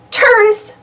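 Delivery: high teasing little knight; joking knight. A narrator's reading. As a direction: tease like a tiny knight. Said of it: "jokes the other knight".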